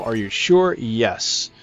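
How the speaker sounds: normal talking voice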